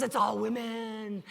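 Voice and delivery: drawn-out mock lament; slow, hammed-up complaint. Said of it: "he's, like, just lamenting".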